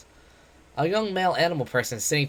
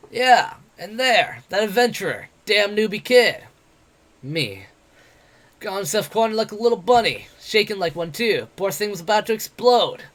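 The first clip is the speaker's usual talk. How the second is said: higher, louder mocking brawler voice; brash, jeering tavern boaster; cocky werewolf adventurer; 7 semitones higher, 5 dB louder than usual. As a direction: taunt him like a swaggering bully. Bete voiced in the story.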